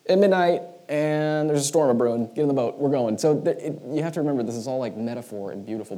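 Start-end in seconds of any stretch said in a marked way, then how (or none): 0.1-3.2 high, loud, urgent call to set off; hammed-up leader ordering everyone aboard; Jesus rallying the disciples. Jesus to his disciples, calling them into the boat, high and loud. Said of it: "So he'd be like".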